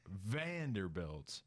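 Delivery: usual speaking voice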